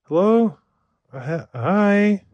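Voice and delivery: high, nervous hello to an upset wife; sheepish honey on the phone; timid phone greeting. Retold or imitated. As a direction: answer like you're in trouble. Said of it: "I answer the phone like".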